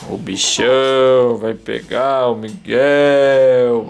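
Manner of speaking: slow, drawn-out mock monster threat; playful monster. Father playing the big monster to tease his baby, slow mock threat. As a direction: creep up on him like a play monster.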